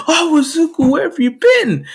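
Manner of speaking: high-pitched anxious mother voice; worried mom. Acted. Worried mother greets child.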